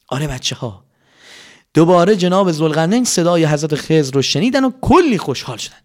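ordinary delivery, nothing marked

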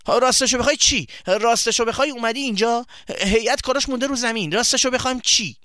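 annoyed friend throwing his words back at him; impatient demand. Acted. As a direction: push him to spit it out. Impatient friend mocking his hesitation.

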